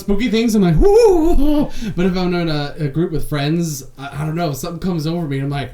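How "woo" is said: high, loud laughing whoop of fake fright; mock scared whoop. Alone with spooky things, a laughing scared whoop. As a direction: let out a hammed-up scared woo.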